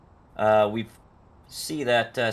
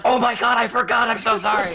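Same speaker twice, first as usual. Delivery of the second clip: high, loud horrified apology; mock panicked shout; 12 semitones higher, 6 dB louder than usual. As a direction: yell it like the panicked friend. Imitating buddy's panicked apology.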